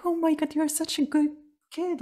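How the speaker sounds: high, fast gushing praise; doctor raving over a brave kid, a hint of mock. Doctor praising the child after the shot, mock gushing high and fast. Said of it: "she was like".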